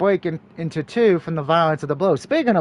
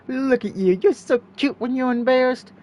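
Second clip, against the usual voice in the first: playful, higher, teasing lilt; flirty teasing; 6 semitones higher than usual. Shown as a demonstration. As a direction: tease her like a flirt. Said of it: "we'll go with romance".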